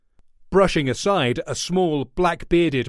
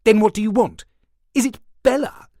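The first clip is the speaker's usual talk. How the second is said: sharp, loud and much higher demand; angry outburst; 9 semitones higher, 6 dB louder than usual. A narrator's reading. Manton rounds on Garvald, angrily raising his voice. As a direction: Manton snaps up at Fred in anger. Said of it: "Manton jumped up angrily".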